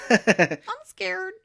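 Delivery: high, loud scared squeal; mock fright. Podcaster mocking the knife scene, squealing in fake fear. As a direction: wail it high in pretend terror. Mocks being scared by Nancy.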